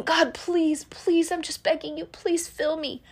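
hammed-up pleading with God; mock begging prayer. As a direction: imitate a desperate begging prayer. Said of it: "If you're begging".

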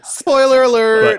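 high, slow, loud cry of spoiler alert; drawn-out playful shout; mock announcement. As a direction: belt it out like a warning siren. Warning about spoilers.

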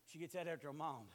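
normal talking voice

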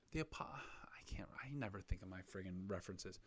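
whispered aside to himself; whispering. Real, not acted. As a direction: drop to a whisper, trailing off. Muttered aside, losing thought.

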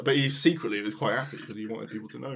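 ordinary delivery

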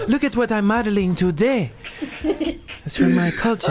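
mock African accent. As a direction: play her showing off in an African accent. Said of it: "She be posting all them African outfits".

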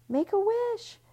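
high, loud party call; a guest calling out to the birthday boy. Acted. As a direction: shout it across the room, high and excited. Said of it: "someone called".

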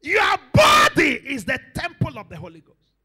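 loud, high, forceful declaration; full-force preaching. Real, not acted. Preacher challenging believers about their body, shouting. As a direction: hammer it home to the congregation.

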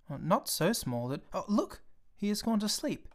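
put-on a little boy charmed by a drawing; mild childlike delight. A narrator's reading.

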